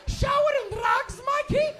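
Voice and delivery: high, slow, loud immigrant mom; accented mom yell. Retold or imitated. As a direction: mom scolds her stoned son. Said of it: "She goes".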